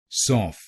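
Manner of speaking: "सॉफ" as sof with an open o; second syllable demo. Shown as a demonstration. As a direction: isolate "सॉफ", open o then f. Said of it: "बोलिए सॉफ".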